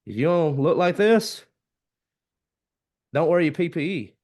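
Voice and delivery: high, loud warning call; imitating a safety speaker's stern line; mock safety warning. Safety speaker warning electricians, high and loud. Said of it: "It was like".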